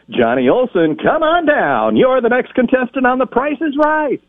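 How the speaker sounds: high, big announcer call of the contestant; game show announcer call. Retold or imitated. Teen on stage calling Johnny Olson down, announcer style. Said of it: "I took the microphone and I said".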